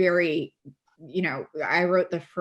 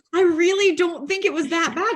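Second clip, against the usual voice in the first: high, louder protest; retold insistence; 11 semitones higher, 5 dB louder than usual. Author defending her book to her assistant, protesting. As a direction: insist it in mock disbelief, voice pitched right up.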